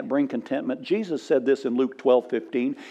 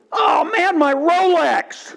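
a high, loud hammed-up wail over the lost watch; wailing cry; 12 semitones higher, 4 dB louder, slower than usual. Businessman wails about his Rolex, hammed-up high crying shout. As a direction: howl it like a man who only mourns his Rolex. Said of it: "And the guy goes".

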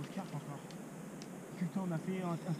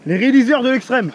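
loud; 4 semitones higher, 18 dB louder than usual. Real, not acted. Loud proud declaration.

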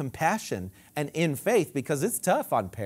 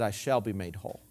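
quiet declaration; 9 semitones lower, 7 dB quieter than usual. Retold or imitated. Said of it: "She said".

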